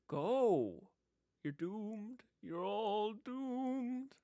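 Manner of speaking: eerie doomsayer, words drawn out; high, slow wail of doom; crazy old man foretelling death; Crazy Ralph. Acted. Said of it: "I would be Crazy Ralph".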